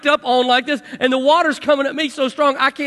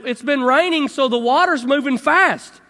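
usual speaking voice

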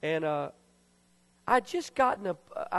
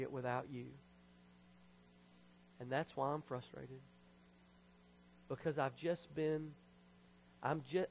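soft, lower, confiding prayer voice; hushed repentant prayer; 3 semitones lower, 6 dB quieter than usual. Retold or imitated. Man re-enacting his apology to God, quietly contrite. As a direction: confess it gently to God, pausing between thoughts.

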